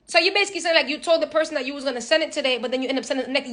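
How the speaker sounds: ordinary talk, nothing marked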